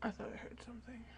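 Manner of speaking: quick, soft, frightened whisper; a hushed, fast whisper from someone scared of a noise. Real, not acted. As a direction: whisper fast as if something might hear. Scared alone, hearing a noise.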